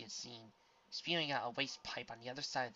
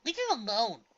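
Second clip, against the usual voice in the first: indignant; 13 semitones higher, 10 dB louder than usual. A narrator's reading.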